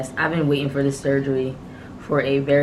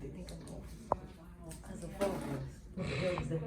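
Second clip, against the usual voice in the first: hushed; 4 semitones higher, 17 dB quieter than usual. Real, not acted. Hushed in hospital room.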